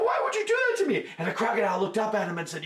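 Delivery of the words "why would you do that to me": upset monkey. Acted. Monkey complains.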